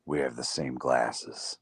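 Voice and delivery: low, slow creepy mutter; lightly voiced creepy stranger. Retelling town creeper's line.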